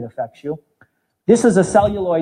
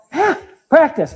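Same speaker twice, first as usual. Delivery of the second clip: eager answer; 10 semitones higher, 3 dB louder, slower than usual. Real, not acted. Answering own quiz.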